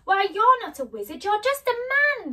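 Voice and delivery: high, loud disbelief; shocked exclamation. Acted. Dorothy discovers the wizard is a man, shocked. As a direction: burst out in indignant shock. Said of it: "she exclaimed".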